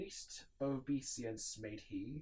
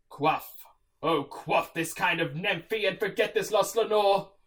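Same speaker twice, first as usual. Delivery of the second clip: louder, urging; fervent plea; 8 semitones higher, 14 dB louder than usual. Acted. Narrator urges himself to drink and forget, loud and fervent. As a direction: beg yourself to forget.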